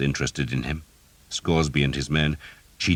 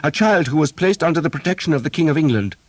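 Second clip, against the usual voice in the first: higher, louder, fast indignant retort; angry, fast; 12 semitones higher, 6 dB louder, faster than usual. A narrator's reading. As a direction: press the point hard and quickly. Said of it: "answered angrily".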